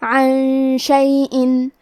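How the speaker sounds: عن شیئن with the ن hidden as a nasal hum before ش, very slow; ikhfa. Shown as a demonstration. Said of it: "غنہ کے ساتھ ادا کریں گے".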